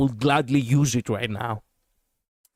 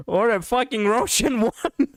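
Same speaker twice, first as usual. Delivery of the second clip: higher, amused, laughing through the words; 8 semitones higher than usual. Real, not acted. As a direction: break into laughter mid-phrase. Amused guessing at character's accent.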